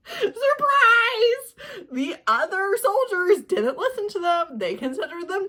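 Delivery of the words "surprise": much higher and louder, laughing mock reveal; hammed-up surprise; bigger sarcastic surprise. Real, not acted. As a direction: oversell the fake shock. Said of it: "sarcastic reveal repeated".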